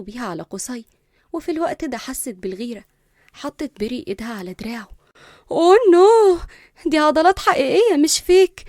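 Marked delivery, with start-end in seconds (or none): 5.5-8.6 high, loud fangirl gushing; swooning admiration. A narrator's reading. Girl touching a stranger's arm, gushing admiration. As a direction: coo loudly over real muscles. Girl fawning over his muscles.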